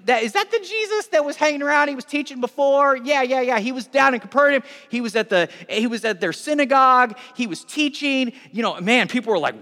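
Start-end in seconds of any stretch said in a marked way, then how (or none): 0.0-8.3 high, loud eager chatter; excited villagers. Acted. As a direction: trade the news eagerly, pitched up. Acting villagers excitedly trading the news.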